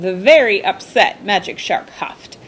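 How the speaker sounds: plain reading voice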